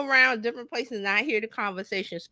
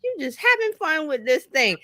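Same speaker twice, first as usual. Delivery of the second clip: teasing quote; 8 semitones higher than usual. Retold or imitated. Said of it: "and I'm like".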